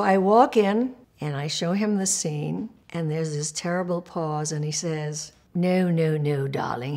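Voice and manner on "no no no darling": imitated English accent, condescending darling; English director. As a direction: put on a British director's refusal. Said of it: "he's English".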